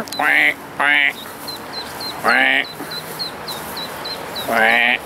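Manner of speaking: mock quacking to call the duckling; imitating a duck call for the lost baby; duck quack imitation. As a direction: quack like a mother duck calling.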